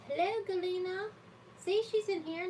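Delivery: high, bright hello to a viewer; high happy welcome; excited greeting. Real, not acted. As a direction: greet her with delight. Greeting a live chat viewer.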